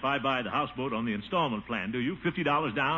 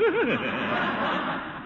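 a rolling, higher chuckle; hearty laugh; 5 semitones higher than usual. Acted. At Peavy's joke, rolling hearty laugh.

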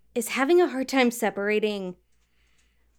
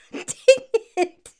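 laughing; 10 semitones higher, 5 dB louder than usual. Real, not acted. Laughing at own stumble.

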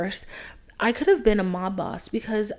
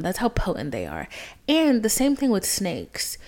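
normal talking voice

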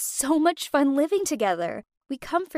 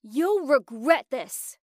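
slow, louder threat; hammed-up furious cry, short of a real scream; 2 semitones higher, 6 dB louder, slower than usual. A narrator's reading. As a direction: draw out the outraged threat loudly. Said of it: "she screamed".

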